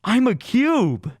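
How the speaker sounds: high-pitched imitation of a tripping friend; mock amazed insistence. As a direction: insist on it in hammed-up wonder. Tripping friend insists he's a cube.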